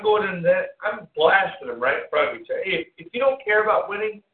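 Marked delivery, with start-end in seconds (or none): 2.6-4.2 mock chewing out. Yelling at imaginary Alex Mack.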